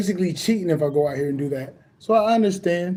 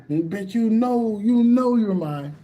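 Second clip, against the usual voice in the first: slow knowing tease; 3 semitones higher, slower than usual. Retold or imitated.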